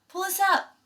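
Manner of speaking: high, loud hollered order; booming holler. Acted. Robot Roz hollering to the bears above, booming holler. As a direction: yell it up the cliff. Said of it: "heard her booming voice holler".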